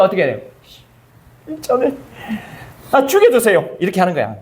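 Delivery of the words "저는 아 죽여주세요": Jonah's plea. Retold or imitated. Jonah begging to die, voiced.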